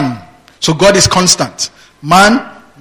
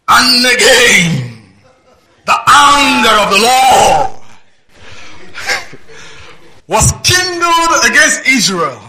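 slow, higher declaiming read; scripture reading; 7 semitones higher, slower than usual. Acted. Preacher reading the verse aloud to the church, slow and higher. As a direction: draw out the verse like a proclamation.

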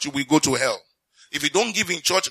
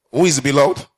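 pressed louder the second time; preacher repeats it louder for emphasis; 7 dB louder than usual. Acted. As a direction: push the question at the congregation.

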